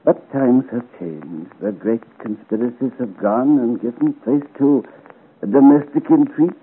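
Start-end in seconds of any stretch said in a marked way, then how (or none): none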